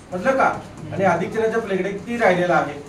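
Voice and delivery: normal talking voice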